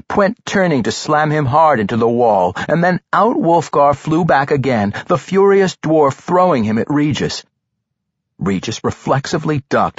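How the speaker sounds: plain reading voice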